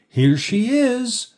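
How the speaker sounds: high, drawn-out singsong greeting; excited announcement. A narrator's reading. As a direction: announce the awaited guest with delight. Ladies greet the late guest's arrival.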